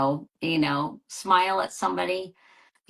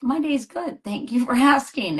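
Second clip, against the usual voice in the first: mock surprised cashier, much higher; a stranger happily caught off guard; lightly played, pleased checkout clerk; grateful cashier; 9 semitones higher, 3 dB louder than usual. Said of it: "And they'd be like".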